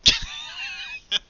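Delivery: loud squealing laugh; high burst of laughter. Real, not acted. Game master bursts out laughing at the scene, squealing. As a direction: crack up at the player's move. GM laughs after NPC line.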